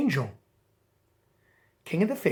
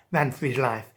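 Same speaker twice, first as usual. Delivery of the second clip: plain reading voice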